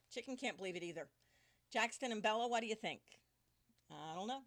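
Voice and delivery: usual speaking voice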